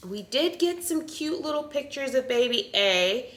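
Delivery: ordinary talk, nothing marked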